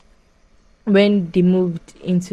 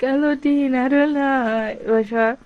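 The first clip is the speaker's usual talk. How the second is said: much higher, slow motherly voice; mother's voice; 7 semitones higher, slower than usual. Retold or imitated. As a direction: play the mother, high and drawn out. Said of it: "the mama is like".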